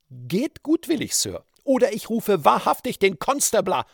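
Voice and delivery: high-pitched threatening cry; woman calling out. A narrator's reading. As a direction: warn him off in a high woman's voice. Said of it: "rief die Frau". (hoher, drohender Ausruf einer Frau)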